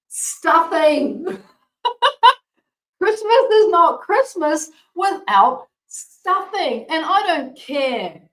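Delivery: high, loud, slow excited announcement; proud reveal to viewers, high and loud. Real, not acted. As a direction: reveal it with a gleeful laugh. Reveal of favourite recipe.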